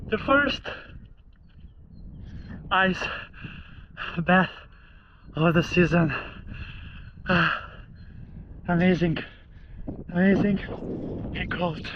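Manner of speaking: celebrate, still catching breath; elated after ice bath. Real, not acted.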